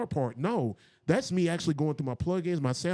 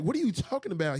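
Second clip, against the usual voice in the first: stunned disbelief; 8 semitones higher than usual. Retold or imitated. Shocked by Emmy news.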